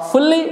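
फुल्ली with doubled l, rising; wrong pronunciation. Shown as a demonstration. Teacher showing how people misread it, wrong pronunciation फुल्ली with long l. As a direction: say फुल्ली as "ful-lee", holding the l. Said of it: "ये pronunciation wrong है".

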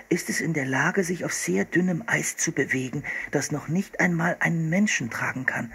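hint of a boy reading from a book; boy reading aloud. A narrator's reading. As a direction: read it out as the eager young brother.

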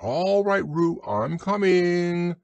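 high, drawn-out reassuring call. A narrator's reading. Christopher Robin calls to Roo while hurrying over, calling out high. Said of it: "called Christopher Robin".

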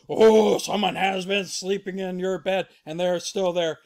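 lightly voiced, loud father bear; Papa Bear. Papa Bear seeing the sleeper, lightly voiced and loud. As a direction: open on a long oh, mildly shocked. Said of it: "Papa Bear".

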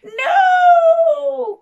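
piercing high shout; witch's cry. Acted. Witch fleeing the dragon, high frantic shout. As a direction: scream it high and loud. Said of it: "cried the witch".

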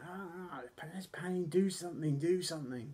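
high mock wail of pain; Tatanka. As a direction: imitate a hurt wrestler begging for help. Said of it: "Tatanka was like".

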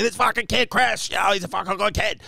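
high-pitched boisterous introduction, imitated; Lenny Clark. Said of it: "Lenny's laughing, introducing me".

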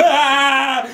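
high scream; sister's scream. A narrator's reading. Sister told spider could pounce: high shriek. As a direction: shriek in fright. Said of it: "You should have heard her scream".

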